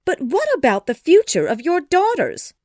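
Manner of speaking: high, loud, dismayed cry; aghast mother, voice shooting up; shocked. A narrator's reading. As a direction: protest in shock as the wife. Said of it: "cried his wife, shocked".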